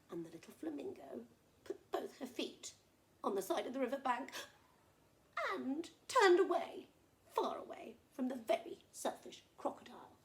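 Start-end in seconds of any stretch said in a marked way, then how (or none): none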